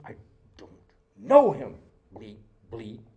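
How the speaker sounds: imitating Peter's sudden shouted curse; mock yelling denial. Peter cursing at the courtyard crowd, mock yell. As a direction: burst out on know him, then drop away. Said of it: "cursing and swearing and yelling".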